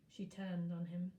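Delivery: plain reading voice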